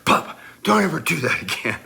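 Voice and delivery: high, loud imitation of an angry daughter; mad Emily. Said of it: "and she was mad".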